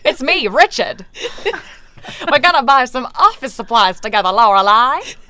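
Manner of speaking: put-on fast, chirpy old-timey flapper; hammed-up Roaring Twenties talk through a laugh; 1920s flapper voice. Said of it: "Doyle also sounds like a flapper".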